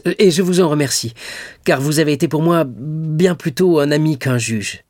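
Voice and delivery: high-pitched, fervent thanks; grateful exclamation. A narrator's reading. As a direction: pour out your gratitude, voice lifted. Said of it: "s'écria Dantès". (remerciement fervent)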